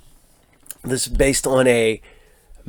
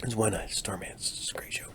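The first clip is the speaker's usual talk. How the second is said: whisper; 3 semitones lower, 16 dB quieter than usual. Real, not acted. Aside to viewers.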